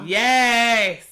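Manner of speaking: a loud, high cheer of delight; excited cheer. Real, not acted. Host cheering her friend's radio success, loud and delighted. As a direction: shout yes for her win.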